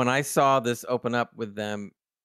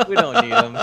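much higher and louder, cracking up mid-line; laughing Tiana impression; 10 semitones higher, 8 dB louder than usual. Host laughing while quoting Tiana's gleeful order. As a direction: squeal it out through laughter. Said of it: "Just like".

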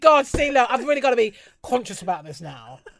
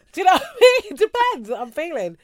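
normal talking voice

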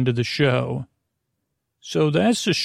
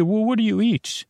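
higher, questioning creature voice; lightly acted animal pal, pitched up; animal friend voice; 7 semitones higher than usual. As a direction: lift the pitch for a curious little character. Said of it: "They say".

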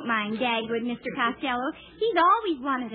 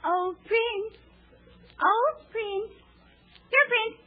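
princess calling out, rising higher; 10 semitones higher, slower than usual. Acted. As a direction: call sweetly to the prince. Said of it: "the princess is calling to her prince".

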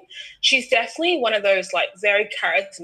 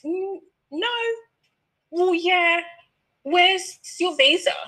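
imitating a high, slow check-in agent; a hint of a prim counter clerk; airline agent; 10 semitones higher, slower than usual. Agent voiced with high pitch.

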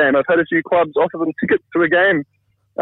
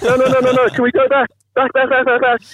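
high-pitched frantic pleading, acted out; mock-desperate, high and urgent; losing it, retold; 10 semitones higher than usual. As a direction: plead frantically in a raised pitch, repeating the words in a rush. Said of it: "I'm losing it at this point".

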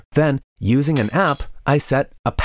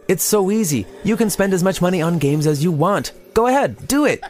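higher, rising, wheedling devil on the shoulder; coaxing devil voice climbing higher to the last words; tempting devil; 7 semitones higher than usual. Acted. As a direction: egg him on like a sly little devil. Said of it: "The devil kept saying".

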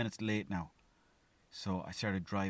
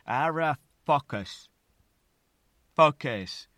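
loud exasperated give-up; loud letting go of the stress; shouting fuck it; 5 semitones higher, 9 dB louder, much slower than usual. Retold or imitated. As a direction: blurt it out, fed up. Giving up on the stress.

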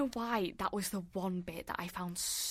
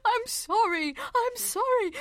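high, wailing mock sob; mock crying Jack; 6 dB louder, slower than usual. Imitating Jack sobbing apologies to Alice. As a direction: whimper like a blubbering man. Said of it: "and he's just crying".

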